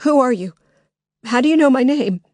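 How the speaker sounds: high, loud, scared questions; Lily demanding the intruder's identity, frightened. Acted. Scared loud questioning.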